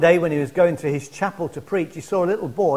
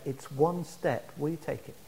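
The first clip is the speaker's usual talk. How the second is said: much softer, lower reply; hushed appeal; 4 semitones lower, 11 dB quieter than usual. Retold or imitated. Street preacher answering his heckler, hushed appeal. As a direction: drop right down into a quiet, pointed invitation.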